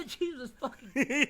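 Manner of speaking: high, slow laughing curse. Real, not acted. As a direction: gasp it out through laughter. Laughing hard.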